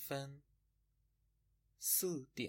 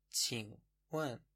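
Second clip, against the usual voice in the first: slow; much slower than usual. Shown as a demonstration. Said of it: "به معنای پرسیدن".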